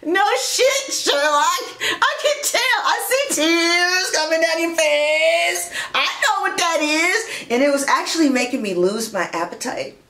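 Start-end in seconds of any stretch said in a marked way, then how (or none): 0.1-7.3 higher, sassy put-down; sarcastic retort. Acted. Reader mocking a man faking tears, sassy sarcasm. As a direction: snap back with exaggerated sarcasm.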